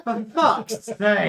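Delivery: loud, high, drawn-out exasperation; exasperated shout. Real, not acted. Exasperated outburst at table's innuendo.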